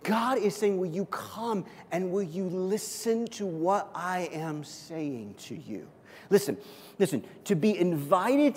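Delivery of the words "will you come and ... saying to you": hushed and slow, voicing God; gentle invitation. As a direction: softly invite them in, slowing and fading to the end.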